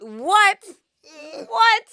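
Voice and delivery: high, loud disbelieving yelp; incredulous outburst. Acted. Guest reacting to talk of a big offer, incredulous. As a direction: burst out in stunned disbelief. Mock outrage at the offer retold.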